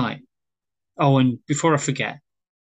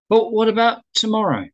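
high-pitched, halting questions; eager, uncertain invitation; tentative hopeful asking; 9 semitones higher than usual. Acted. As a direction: blurt it, then float each question up high. Wayne nervously asks her out.

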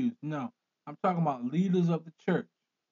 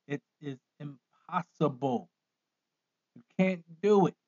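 normal talking voice

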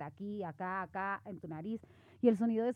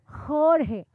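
teacher's neutral "Jorge", said firmly and a bit higher; imitated teacher correcting; 4 semitones higher, 4 dB louder than usual. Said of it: "el maestro decía". (imitando al maestro que corrige "Jorge")

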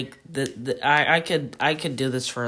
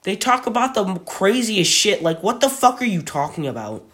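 louder, higher exasperated rant; worked up; 6 semitones higher, 7 dB louder than usual. Real, not acted. Ranting to her friend about rich people, loud and worked up.

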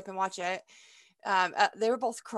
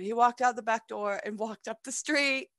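ordinary talk

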